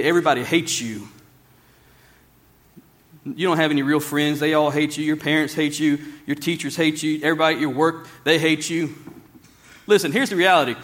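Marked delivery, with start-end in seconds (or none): 0.0-9.0 low, monotone litany of hate; accusing inner voice. Acted. Preacher voices the lie you'll hear, low and accusing. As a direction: keep it low and level, line after line.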